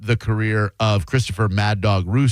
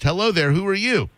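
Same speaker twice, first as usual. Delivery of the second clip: higher, sing-song hello; high playful greeting; 9 semitones higher than usual. Pretend. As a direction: talk up like to a kid. Greets child-voiced character.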